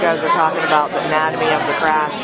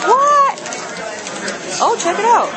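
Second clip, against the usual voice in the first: surprised; 11 semitones higher than usual. Real, not acted. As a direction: react with a startled yelp. Sudden surprise at something.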